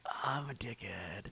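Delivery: slow, hushed aside to himself; deflated, breathy-quiet admission; sheepish self-reproach. Retold or imitated. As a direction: drop to a near-whisper, owning up. Retold sheepish realization to himself.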